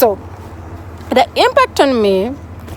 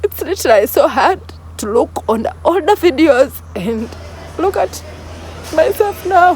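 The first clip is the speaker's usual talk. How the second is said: fighting tears, the voice climbing higher at the end; holding back tears; 6 semitones higher than usual. Real, not acted. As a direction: push through the words while near crying. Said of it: "I broke down making this video".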